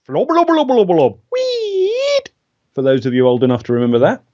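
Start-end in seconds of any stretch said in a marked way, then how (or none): 0.1-1.2 nonsense puppet babble, starting high; silly flubbery gibberish; Bill and Ben. Pretend. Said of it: "have to be the Bill and Ben the Flubber". 1.3-2.2 very high, drawn-out puppet squeak; tiny, piping cartoon voice; Bill and Ben. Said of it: "have to be the Bill and Ben the Flubber".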